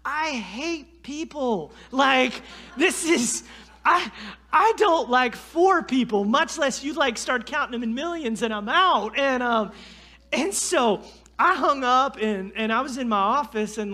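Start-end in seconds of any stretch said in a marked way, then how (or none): 0.0-9.2 mock rant. Ranting about hating people.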